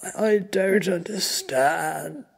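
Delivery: high, loud, slow stammer; frightened, faltering victim; choked bewilderment. A narrator's reading. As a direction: plead in strained confusion. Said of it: "He coughs".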